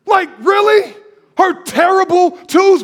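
high, loud disbelief; incredulous shout. Real, not acted. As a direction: throw the excuse back in disbelief. Preacher mocking the excuse.

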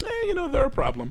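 mock excusing a friend's outrageous remark; mock shrugging reply. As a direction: shrug it off in a silly high voice. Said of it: "and I'm like".

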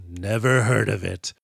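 slow, low, deadpan put-down; unimpressed mock general, drawn out; dismissive. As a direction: drop the pitch and drag it out dismissively. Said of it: "Pinkley's smile disappears, shakes his head".

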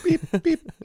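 laughing beeps. Retold or imitated. Mimicking self-checkout beeps.

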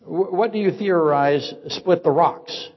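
normal talking voice

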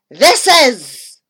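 delighted dentist; gleeful cry. A narrator's reading. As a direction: cry it out with obvious glee. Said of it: "he cried with obvious glee".

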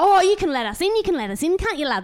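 high, loud wheedling plea; loud high whine of a tweaker asking a favour; imitating a tweaker. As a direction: mimic a jittery junkie begging to be let in. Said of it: "like that, that kind of vibe".

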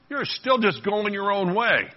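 high, drawn-out reproach; exasperated scolding. Acted. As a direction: stretch it out like someone at the end of their patience. God scolding the stubborn, acted.

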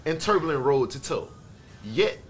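slow, low, solemn recital; dramatic reading. Acted. Host reading August's post to friends as a slow, solemn recital. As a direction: draw each phrase out low and soft, with weighty pauses.

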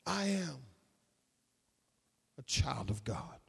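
very soft, low, near-whispered close; solemn, fading almost to a whisper; hushed. Real, not acted. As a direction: drop to a low hush with long pauses.

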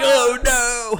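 high mock dog whimper Oh no; mock dismay. Friend voicing the stuck dog in mock dismay. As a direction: whine it high like the trapped dog. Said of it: "You're like".

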